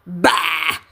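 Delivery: put-on low gruff old miser; gruff bah. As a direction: spit it out dismissively. Scrooge answers nephew gruffly.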